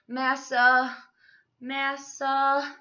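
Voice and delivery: loud wind-up to a sneeze; mock sneeze wind-up; sneeze building. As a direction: stretch the name into a coming sneeze.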